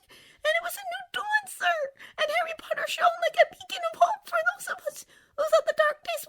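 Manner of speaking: very high, whispery house-elf squeak; a tiny frantic elf pleading; Dobby voice. A narrator's reading. Tearful house elf begging Harry Potter, high and whispery.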